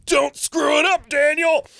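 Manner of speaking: high, loud shout to himself; self-warning shout. Retold or imitated. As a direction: yell it at yourself. Retold self-pep talk.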